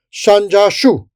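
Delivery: high, loud battle shout. A narrator's reading. Captain commanding the crusaders, shouting. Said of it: "the captain shouted".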